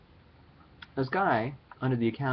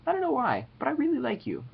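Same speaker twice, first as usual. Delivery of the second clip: mock gushing. Gushing shout-out to a fan.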